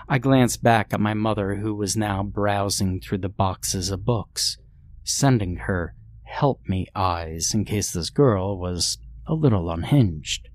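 ordinary delivery, nothing marked

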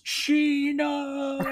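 very high, drawn-out cry of a name; mock yell. Host as vanishing uncle calling his niece, drawn-out mock yell. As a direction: wail it long like someone being dragged away. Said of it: "and he yells".

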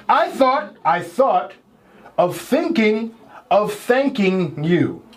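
slow, word groups set apart; careful retake. Shown as a demonstration. Host reading a tongue twister to contestants, slow and careful. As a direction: read it out deliberately with pauses.

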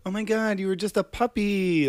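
mock gush. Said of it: "she's like".

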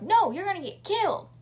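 alarmed. Retold or imitated. Friends warn of death.

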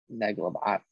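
"Mönchengladbach" mangled into something like "neglabah"; botched German pronunciation. Real, not acted. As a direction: stumble through "Mönchengladbach", swallowing its first syllable. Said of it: "I don't take German".

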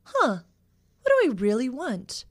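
high, loud mock wondering; pondering. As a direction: act out a puzzled self-question. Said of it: "sitting down and going".

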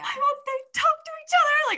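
high, loud, whiny cry of frustration; mock distraught wail. Venting to Anna late at night about the book, a mock distraught wail. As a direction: wail it like a fan at her wits' end. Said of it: "Like, I was not okay".

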